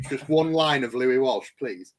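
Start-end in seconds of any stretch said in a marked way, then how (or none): none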